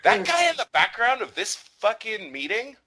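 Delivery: high-pitched, drawn-out disbelief; incredulous comic rant. Real, not acted. Host argues to co-host about gene pools, incredulous rant. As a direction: build the case in a high, insistent voice.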